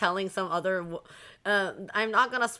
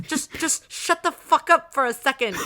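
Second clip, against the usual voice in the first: higher, louder exasperated snap; irritated outburst; 7 semitones higher, 6 dB louder than usual. Retold or imitated. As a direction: finally lose patience with the kid. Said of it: "He's like".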